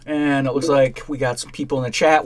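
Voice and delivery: normal talking voice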